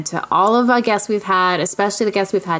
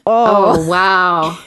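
grossed out; 5 dB louder than usual. Real, not acted. Grossed-out reaction to buff dog.

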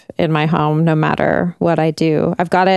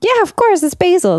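excited; 12 semitones higher than usual. Real, not acted. Eager answer about the basil.

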